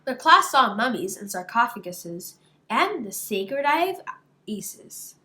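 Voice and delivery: plain reading voice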